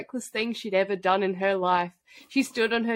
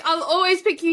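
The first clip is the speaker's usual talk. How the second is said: higher, louder melodramatic vow; mock heroine vow; 8 semitones higher, 5 dB louder than usual. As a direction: overplay the tender promise. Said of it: "She's like".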